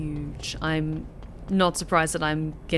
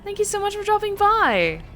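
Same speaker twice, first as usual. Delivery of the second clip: put-on American accent greeting; 11 semitones higher, 3 dB louder than usual. Streamer welcoming a viewer dropping by, high-pitched American accent. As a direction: greet them brightly in a practiced American accent. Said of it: "work on my American accent".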